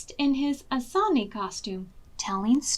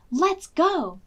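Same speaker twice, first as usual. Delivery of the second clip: high, loud eager call; rally the family to go; eager cry; 7 semitones higher, 5 dB louder than usual. A narrator's reading. Selma rallies the family, eager high call. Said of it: "cried Selma".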